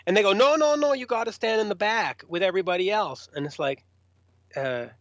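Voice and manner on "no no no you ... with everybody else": insistent line official. Retold or imitated. Festival staff send the band to the back, insistent. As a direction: imitate an official refusing queue-jumpers.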